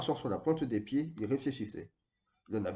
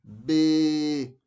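a goat's quaver, higher and louder; bleating; 3 semitones higher, 7 dB louder, much slower than usual. A narrator's reading. Boy bleating the word like a sheep. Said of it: "bêla Moka".

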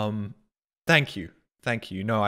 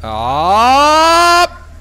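long, loud rising "aah"; frustrated yell; 7 semitones higher, 8 dB louder than usual. Real, not acted. Streamer stuck in a game, yelling in frustration. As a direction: let out a yell that climbs high.

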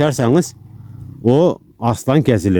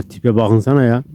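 ordinary delivery

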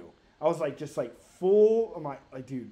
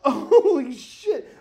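long drawn-out, loud, higher amazement; stunned exclamation; 7 semitones higher, 8 dB louder, much slower than usual. Retold or imitated. Reliving the car landing in front of him, stunned drawl. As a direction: stretch it out in acted shock.

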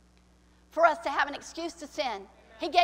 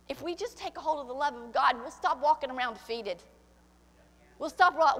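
ordinary talk, nothing marked

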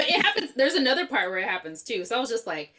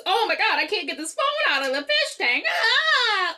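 higher and louder, laughing through a hammed-up panicked cry; mock panic; 8 semitones higher, 4 dB louder than usual. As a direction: overplay her freaking out over the fish tank. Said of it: "you know, she just".

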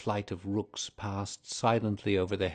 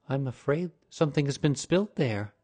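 lady's concern; 5 semitones higher, 4 dB louder than usual. A narrator's reading. Lady notices stain, voiced.

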